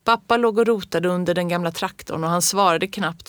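ordinary delivery, nothing marked

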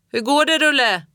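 loud calling out on the slope; called out. A narrator's reading. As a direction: shout to be heard over the storm. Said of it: "ropade pappa".

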